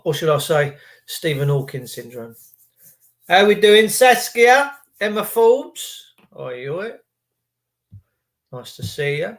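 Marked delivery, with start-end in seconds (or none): none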